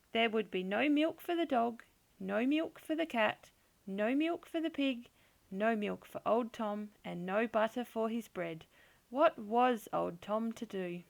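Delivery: usual speaking voice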